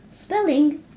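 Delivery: a class shouting an answer; children crying out. Acted. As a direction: call out the answer together. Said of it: "cried the children".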